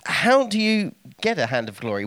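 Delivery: normal talking voice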